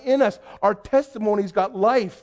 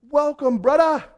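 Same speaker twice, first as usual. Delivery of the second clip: high, drawn-up cheery welcome; imitating a jovial welcome on the phone; hearty greeting; 7 semitones higher than usual. Church man welcomes the visiting youth pastor by phone, hearty greeting.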